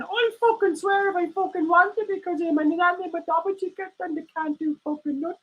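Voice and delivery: high defiant meerkat voice; cheeky puppet voice. Acted. Puppet meerkat mouthing off to its owner, high and defiant. Puppet Alan answers back.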